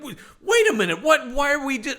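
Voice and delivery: fast, high-pitched mock bafflement; baffled protest. Baffled viewer hearing Frankenstein's in jail for that murder, incredulous. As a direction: stop short in acted disbelief.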